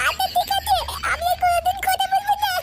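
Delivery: high small pleading surrender; frightened boy voice. Acted. Beaten boy promises the father he will stop. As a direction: give in, cowed.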